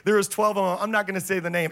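ordinary talk, nothing marked

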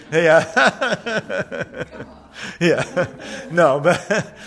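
laughing it off; real laughter. Real, not acted. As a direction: chuckle through the words. Laughs after spider story.

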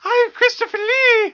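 very high, slow squeaky voice. Pretend. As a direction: squeak it out like a cartoon. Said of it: "say it in a squeaky voice".

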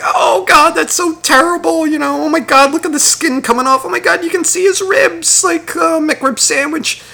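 high, whiny mock sobbing voice; mocking crying moviegoers. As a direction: mimic weepy viewers, high and whiny. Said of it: "bawling and being like".

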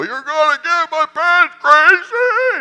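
very high, loud and slow mock wail; mock panicked shriek. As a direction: shriek in hammed-up alarm over the pants. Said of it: "And I'm like".

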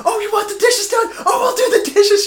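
very high, loud hammed-up fury; mock furious shriek. Dee screaming at the group before smashing every dish. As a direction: shriek it as she smashes plates.

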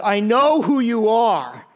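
slow, loud demon declaration; demon declaring. Acted. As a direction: drag it out, menacing recognition. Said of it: "demon-possessed man points it out".

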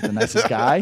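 laughing. Real, not acted.

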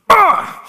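sudden high loud cry of fervour; excited shout. Real, not acted. Preacher to her congregation, a high excited cry. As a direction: let out a burst of joyful zeal. Preacher bursting out about courage.

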